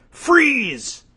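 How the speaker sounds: high, falling yelled command; mock yell. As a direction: order them to halt in an acted yell. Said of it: "what do they yell?".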